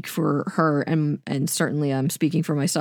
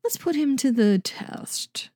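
scheming Hannibal; 8 semitones higher, 4 dB quieter than usual. Retold or imitated. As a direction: toy with him like a cold-blooded schemer. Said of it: "thinking that Hannibal was like".

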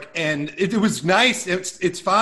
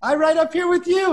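put-on naive kid voice; 10 semitones higher than usual. Teen explaining to the cab driver why he sits up front, high and eager. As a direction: play a clueless teen trying to be friendly.

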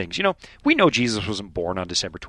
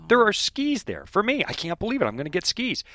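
higher-pitched, thrilled disbelief, acted out; retold childlike excitement; 8 semitones higher than usual. Retelling finding a long package under the tree, excited. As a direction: replay a kid's giddy discovery.

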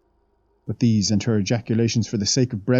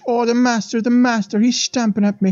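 put-on higher, the child's pleading cry relayed by the maid; mock terrified screech; 13 semitones higher, 4 dB louder than usual. The maid imitates the dying child begging her mother, a mild higher cry. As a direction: cry out like a haunted little girl, but only retelling. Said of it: "and then she'd screech".

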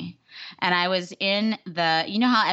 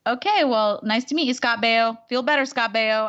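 higher, forced friendly goodbye; awkward politeness; 5 semitones higher, 3 dB louder than usual. Retold or imitated. Reenacting leaving the stranger for her pitch, awkward politeness. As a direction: overdo the pleasantries with lifted pitch. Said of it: "I just kept saying it like that".

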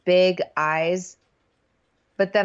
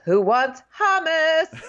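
high, drawn-out singsong call; comic over-keen hostess; mock eager offer; 8 semitones higher, 4 dB louder, much slower than usual. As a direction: call out slowly and high, hammed up. Imitating eager contestant offering food.

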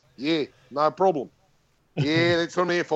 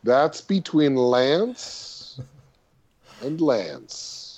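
slow deadpan; 2 semitones lower, much slower than usual. Real, not acted. Dry joke, slow drawn out.

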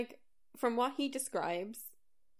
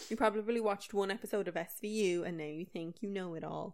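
ordinary talk

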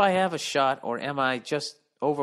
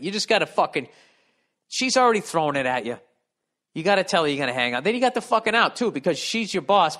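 usual speaking voice

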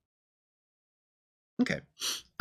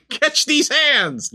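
slow, high, drawn-out battle cry; 12 semitones higher, much slower than usual. Pretend. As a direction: drag out each word like a war cry. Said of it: "No, really".

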